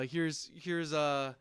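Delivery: normal talking voice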